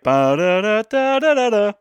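high slow sing-song ba-da-da; imitating a little musical riff; mimicked jingle. Co-host imitating a friend's tune, sing-song. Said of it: "he was like".

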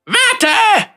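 laut und hoch rufen; donnernder Ruf. Acted. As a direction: sie scharf zurückrufen. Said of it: "donnerte er". (donnernd rufend)

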